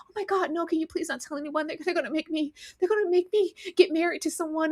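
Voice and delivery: soft, high, frantic begging; hushed panicked plea. Acted. Girl begging her friend to keep quiet, hushed. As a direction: plead softly so no one hears. Daisy voiced, begging secrecy.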